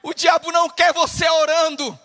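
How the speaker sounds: loud, emphatic preacher's cry; shouted preaching. Real, not acted. Preacher warning the congregation about prayer, shouted. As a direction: drive the warning home at a shout.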